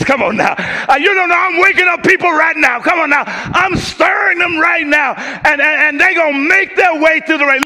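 high, loud preacher's call; full-force gospel preaching; fervent preaching. Acted. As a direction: whip up the congregation.

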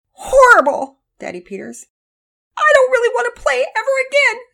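put-on high, loud, upset child's wail; sobbing. A narrator's reading. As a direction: wail it tearfully to a parent. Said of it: "Penelope was sobbing".

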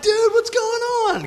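high-pitched, friendly hail; excited greeting. Retold or imitated. Comedian hailing a kid he knows from the road, excited greeting. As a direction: greet him in a high, stretched-out voice.

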